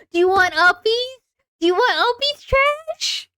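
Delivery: high, rising cooing questions; baby talk. Acted. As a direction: ask like talking to a toddler. Baby-talk offering uppies.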